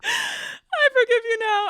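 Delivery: high, loud squeal through laughter; tearful laughing wail. Real, not acted. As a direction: wail forgiveness while cracking up. Laughing, forgives the boy aloud.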